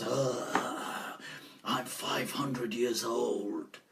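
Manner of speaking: low, slow, quiet jinn voice; ancient jinn. Retold or imitated. Jinn answering how old he is, low slow voice. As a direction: draw it out low like an ancient spirit. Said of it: "So he goes".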